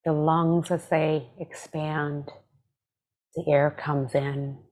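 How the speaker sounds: calm, measured meditation voice with long pauses; guided meditation. Acted. Meditation teacher leading listeners through breath and body scan, calm guided meditation. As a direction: guide them gently, leaving space after each phrase.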